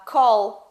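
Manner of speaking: "call" said loud with a clear final L; final L pronounced. Shown as a demonstration. Teacher giving an English example, final L pronounced. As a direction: sound the L at the end of "call". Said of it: "ele precisa ser pronunciado".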